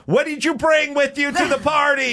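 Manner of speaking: high, loud yelled demand; teacher yelling. Retold or imitated. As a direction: shout the question at the actor. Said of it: "And she'd yell".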